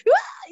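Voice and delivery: high excited shriek; freaking out. Retold or imitated. Hearing from the realtor they got the house, high squeal. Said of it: "freaking out".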